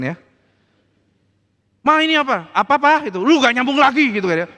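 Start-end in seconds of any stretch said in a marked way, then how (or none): 1.8-3.1 loud, high scornful taunt; mocking jab. Husband belittling wife, acted. 3.2-4.1 loud, high scornful taunt; mocking jab. Husband belittling wife.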